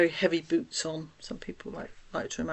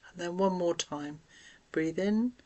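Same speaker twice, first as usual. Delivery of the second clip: guided breathing; 4 dB quieter than usual. Acted. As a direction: gently talk them through each breath, leaving room to breathe. Leads deep breathing exercise.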